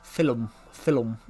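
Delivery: put-on "film" in a Geordie accent, as two syllables, "fillum"; mimicked Geordie accent; Geordie lecturer. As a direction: imitate a Geordie saying "fillum". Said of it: "my old uni lecturer was a, was a Geordie".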